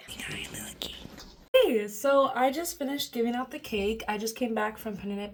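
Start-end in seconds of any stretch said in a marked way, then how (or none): 0.1-1.0 unvoiced playful whisper. Real, not acted. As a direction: whisper it like a secret. Whispered aside.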